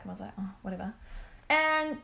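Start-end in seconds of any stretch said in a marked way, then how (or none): 0.4-0.9 very soft retold brush-off; taken aback. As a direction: drop your volume, imitating someone put out. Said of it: "like, real taken aback".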